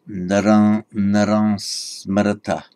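naran with a nasal vowel, no final m, said slowly; northern Indian pronunciation. Shown as a demonstration. Teacher showing how a northern Indian would say naran. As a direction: say naran as na-ra with the vowel through the nose. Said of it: "It would be pronounced by a northern Indian today as".

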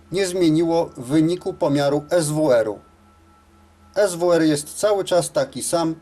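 usual speaking voice

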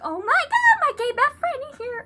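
high-pitched, hammed-up delight; gushing relief. Greeting the friend who rescues her, gushing relief. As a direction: gush it high, starting loud.

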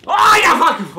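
high, loud yell; hammy high-pitched holler; sudden outburst. Acted. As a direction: shriek it out theatrically. Sudden outburst after his rant.

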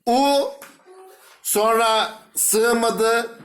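U, ayrı hece olarak; syllable split. Shown as a demonstration. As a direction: hece hece ayırarak. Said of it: "Uçurtma şeklinde yazılır". (heceleri ayırarak)

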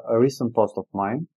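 ordinary talk, nothing marked

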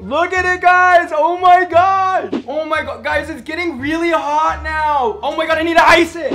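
high, loud, frantic calling to viewers; loud alarmed excitement. Real, not acted. Vlogger calling to his viewers about his hot ear, frantic loud shouting. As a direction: yell it out as the ear heats up. Ear burning after the piercing.

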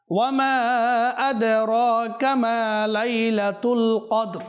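slow melodic chant with drawn-out vowels; slow Quran recitation. Shown as a demonstration. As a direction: recite the verse slowly in tajweed. (ধীর সুরেলা তিলাওয়াত)

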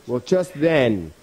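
usual speaking voice

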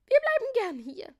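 put-on very high, loud girlish voice; volunteer in a high, bright shout; eager call. A narrator's reading. Melissa and Rosalinde volunteering, high eager call. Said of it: "riefen Melissa und Rosalinde".

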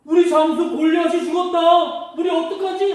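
high, panicked exclamation after a gasp; loud, high mock panic; startled Philistine army. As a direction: cry out in hammed-up alarm. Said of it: "깜짝 놀랐어요".

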